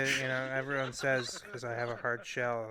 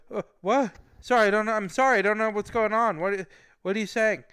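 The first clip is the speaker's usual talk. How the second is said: high, loud, panicky apology; re-enacting his rattled self; nervous, flustered; 7 semitones higher, 7 dB louder than usual. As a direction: plead confusion while being yelled at. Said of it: "he made me very nervous".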